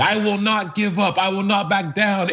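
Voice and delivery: lightly voiced Trump defiance; half-hearted Trump impression; imitating Trump. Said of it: "radio sweeps of Trump saying".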